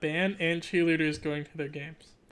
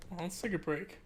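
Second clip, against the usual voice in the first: ordinary delivery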